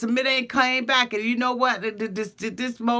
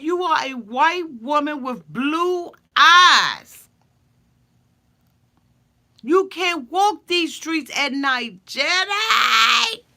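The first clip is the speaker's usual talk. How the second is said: slow, loud, rising to a yelled Jenny; hammed-up alarm about the streets at night; mock warning shout; 5 semitones higher, 5 dB louder, slower than usual. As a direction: warn her dramatically, building to a shout. Submit warning Jenny of danger.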